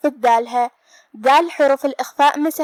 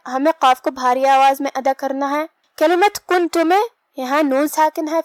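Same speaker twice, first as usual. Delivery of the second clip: ordinary talk, nothing marked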